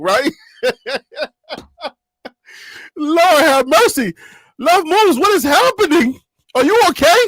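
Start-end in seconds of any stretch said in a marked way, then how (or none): 3.0-7.2 exasperated pleading. Real, not acted. Pleading over hacked auntie.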